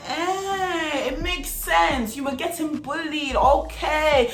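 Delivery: high, drawn-out singsong mockery; mocking realization. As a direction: draw it out high, as if it all clicks. Acts out her mocking realization.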